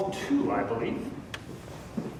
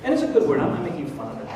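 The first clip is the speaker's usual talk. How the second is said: usual speaking voice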